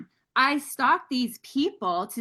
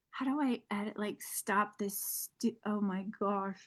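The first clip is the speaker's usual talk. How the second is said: panicked muttering; 3 semitones lower, 10 dB quieter than usual. Retold or imitated. Panicking while live.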